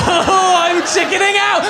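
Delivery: high, loud, slow laughing squeal. Real, not acted. Comic losing his nerve naming a school friend, squealing with laughter. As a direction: laugh it out in nervous panic. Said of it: "laughing nervously".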